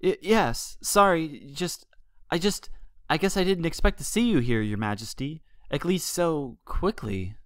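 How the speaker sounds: sheepish. A narrator's reading. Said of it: "a tone that quickly grew sheepish".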